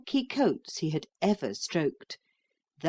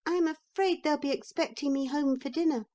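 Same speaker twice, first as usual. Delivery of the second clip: put-on much higher, polite little boy voice; a little boy making a polite excuse; little boy excusing himself to the horse, high and polite; small boy; 9 semitones higher than usual. A narrator's reading. Neville politely declines.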